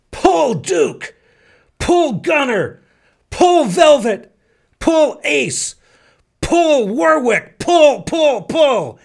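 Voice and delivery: loud, high farmer's holler in slow bursts; calling to a mule. Retold or imitated. Farmer snapping the reins on his mule, high shouted commands. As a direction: holler each command as if driving a team.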